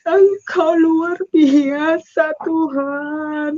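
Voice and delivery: wailing, very slow praise; anguished, stretched-out cry to God; tearful prayer. Retold or imitated. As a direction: draw it out through tears. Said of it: "Saya menangis".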